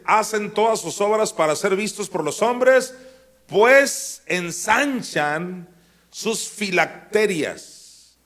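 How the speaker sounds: high, loud preacher reading the verse; emphatic pulpit reading; proclaimed scripture reading. Acted. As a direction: proclaim it, slowing and stretching the final words. Reads scripture at full force.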